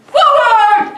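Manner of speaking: loud, high-pitched shout; big, high FORWARD as a command; yelled command. Shown as a demonstration. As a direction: yell it out like a game call. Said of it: "I will yell out".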